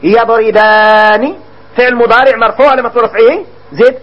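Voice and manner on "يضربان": يضربان drawn out slowly, ending ني lengthened; slow emphasized example. Shown as a demonstration. Teacher showing the nun ending, slow and drawn. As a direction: stretch يضربان to show the nun. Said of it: "بالنون".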